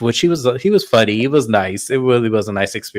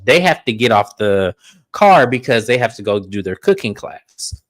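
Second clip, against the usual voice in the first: ordinary talk, nothing marked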